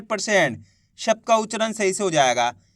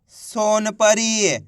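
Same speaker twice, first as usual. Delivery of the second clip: very drawn-out model word; slow blended reading; much slower than usual. Shown as a demonstration. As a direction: stretch every syllable while joining them into one word. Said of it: "इनको आपस में मिला के पढ़ना है". (अक्षर मिलाकर खूब खींचकर पढ़ना)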